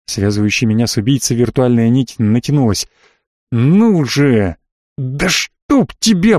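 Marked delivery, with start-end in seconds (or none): 3.5-6.4 frustrated growl. A narrator's reading. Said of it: "прорычал я вслух".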